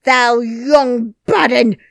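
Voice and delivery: loud, slow scornful Yorkshire insult; angry call down. Acted. Ben calls down at Mary, loud, slow and outraged. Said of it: "he called down at her".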